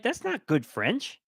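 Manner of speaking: high-pitched mock disbelief; incredulous French speakers. French speakers react to an actor's bad French, mock incredulous. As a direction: voice puzzled native speakers, pitched well up. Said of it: "people who speak French are gonna be like".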